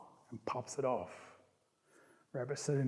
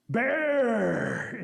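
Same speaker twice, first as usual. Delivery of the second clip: loud hollered name, higher; 6 semitones higher, 5 dB louder than usual. Acted. Eagle calling bear forward, a holler. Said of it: "Eagle hollers out".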